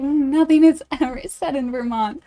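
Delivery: usual speaking voice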